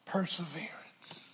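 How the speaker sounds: breathy whispered word; whispered perseverance. Real, not acted. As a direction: drop to a hushed whisper for effect. Preacher whispers the key word.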